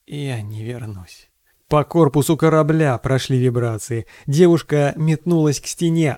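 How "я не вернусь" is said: very soft, slow, lifeless; grey, dead voice. A narrator's reading. Doomed pilot telling his captain his decision, dead-voiced and slow. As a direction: let all hope drain out, slow and hushed. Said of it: "Серым, мёртвым голосом".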